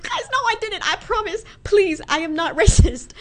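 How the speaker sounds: ordinary talk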